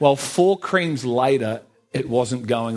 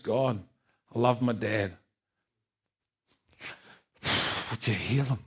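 soft prayer; 3 semitones lower, 6 dB quieter than usual. Retold or imitated. Quiet prayer for his dad.

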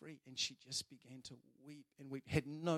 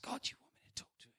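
a fast, breathless whisper to God; whispered prayer; faster than usual. Retold or imitated. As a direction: whisper the question anxiously. Retold anxious prayer to God.

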